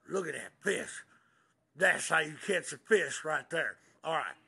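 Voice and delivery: normal talking voice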